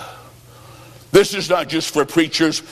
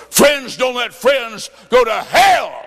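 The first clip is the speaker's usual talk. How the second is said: preaching at full force; 6 semitones higher, slower than usual. Acted. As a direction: thunder it out to the congregation. Preaching climax.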